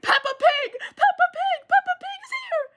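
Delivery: high, loud frantic yelling with a laugh; delirious shout. Retold or imitated. Feverish camper grabbing her cabinmate, shrieking high and loud. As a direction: shriek it like a feverish girl seeing a cartoon. Hallucinating girl shakes the narrator.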